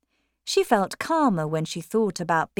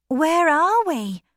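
put-on uneasy high young woman; 10 semitones higher, 6 dB louder, slower than usual. Maggie asks uneasily.

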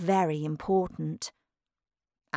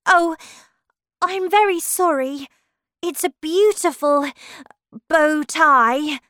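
put-on high-pitched, louder girl character; apologetic Alice; 9 semitones higher, 9 dB louder than usual. Alice apologising for mistaking the bow tie, high-pitched and placating.

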